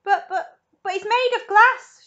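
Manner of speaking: high, loud, stammering protest; alarmed shout. A narrator's reading. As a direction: protest in panic. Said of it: "shouted Grandpa Joe".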